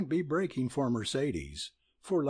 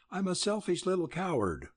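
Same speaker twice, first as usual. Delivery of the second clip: higher and fast, self-reproach; crying out; 7 semitones higher, faster than usual. Acted. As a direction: blurt it out in distress. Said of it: "cried Nell".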